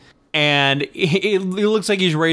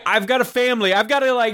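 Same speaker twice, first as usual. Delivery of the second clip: mild mock yell; imitated angry yelling; 10 semitones higher, faster than usual. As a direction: act out an exhausted man's outburst, pitched up. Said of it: "angrily starts yelling about how tired he is".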